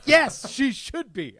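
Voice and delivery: high, drawn-out exasperated yes; emphatic retort. Retold or imitated. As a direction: blurt it as an emphatic comeback. Acted emphatic reply in anecdote.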